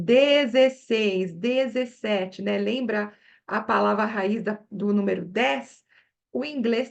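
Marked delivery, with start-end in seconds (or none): 0.0-2.4 dezesseis, dezessete said slowly, each syllable drawn out; slow model words. Shown as a demonstration. Teacher shows the Portuguese numbers slowly, stressing the roots. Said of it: "usa bastante assim".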